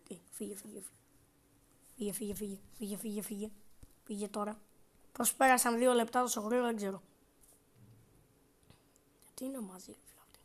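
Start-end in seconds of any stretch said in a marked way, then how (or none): 0.1-4.6 hushed, urgent repeated go away; urgent whisper. Real, not acted. Κρυμμένος, διώχνει τη γιαγιά. 9.2-10.4 hushed, breathless question; whisper. Real, not acted. Ψιθυρίζει κρυμμένος.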